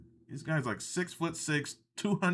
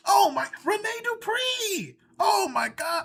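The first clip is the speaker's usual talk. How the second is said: much higher, louder cry of delight; excited outburst; 4 dB louder, slower than usual. Real, not acted. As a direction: burst out thrilled at the name. Thrilled at French wrestler mention.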